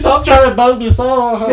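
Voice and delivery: high, loud teased shout; loud mock-annoyed yelp; laughing protest. Real, not acted. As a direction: cry out in playful protest, breaking into laughter.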